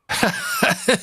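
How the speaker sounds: sudden shrieking burst of laughter; high mocking laugh. Real, not acted. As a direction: cackle at the listener. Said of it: "I'm laughing at you".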